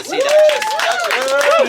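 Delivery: loud, high, slow crowd hype; rally the crowd like a host; announcer hype. Acted. Captain hyping crew at trip welcome.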